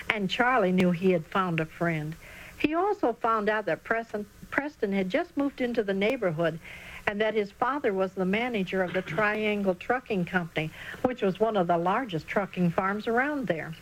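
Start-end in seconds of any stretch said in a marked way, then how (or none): none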